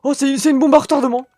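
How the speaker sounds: loud, high startled yell; alarmed shout. Real, not acted. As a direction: shout it, caught off guard. Une bombe lui est lancée. (cri de surprise affolé)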